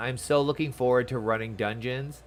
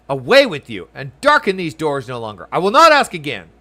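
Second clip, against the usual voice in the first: louder, sharper guard driving them away; angry dismissal; 2 semitones higher, 11 dB louder than usual. Acted. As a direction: bark at them to leave, rising on the last line.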